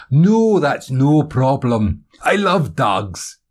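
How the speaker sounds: slow, loud, hearty Scottish reassurance; high, drawn-out Scottish delight; smiling Scottish accent. Acted. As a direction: draw it out genially in a Scottish accent. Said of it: "he said with a smile".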